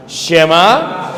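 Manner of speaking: loud call-and-response lead; call to repeat. Shown as a demonstration. Teacher calls the Shema out for the class to repeat. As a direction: call each word out for the class to echo. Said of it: "Otra vez".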